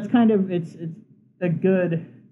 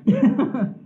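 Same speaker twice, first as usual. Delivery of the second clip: quick high chuckle; brief amused laugh; short laugh; 9 semitones higher, 4 dB louder than usual. Real, not acted. Laughs at own boast.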